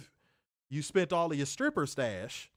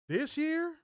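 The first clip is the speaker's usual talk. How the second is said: squeaky hype; 13 semitones higher than usual. Acted. Hyping up the year.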